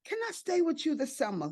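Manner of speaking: put-on high, quick child's plea; eleven-year-old asking. Granddaughter asking Nana to stay the summer, high and eager. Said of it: "So the eleven-year-old said".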